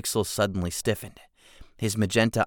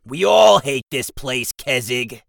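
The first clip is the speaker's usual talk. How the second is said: goblin voice; 5 semitones higher, 9 dB louder, slower than usual. Acted. Goblin Jigsaw replies.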